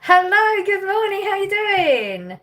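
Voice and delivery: high, loud, singing hello; bright greeting. Real, not acted. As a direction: greet the viewers with a big lift. Greeting viewers on a livestream.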